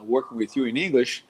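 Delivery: ordinary delivery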